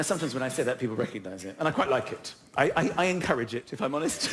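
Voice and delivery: usual speaking voice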